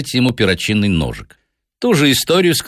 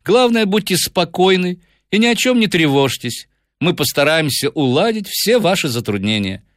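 high-set, confident detective voice; composed detective taking charge; Holmes reassuring his client; 9 semitones higher than usual. A narrator's reading. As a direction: give assured instructions in a higher register.

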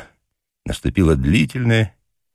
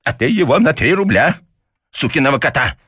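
put-on higher, firm old man's verdict; convinced; 10 semitones higher than usual. A narrator's reading. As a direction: say it as settled truth. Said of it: "убеждённо заметил папаша".

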